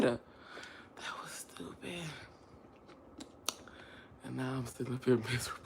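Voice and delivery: whispered. Real, not acted. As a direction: breathe it out ashamed. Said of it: "What a dummy".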